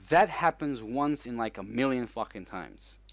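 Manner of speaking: ordinary talk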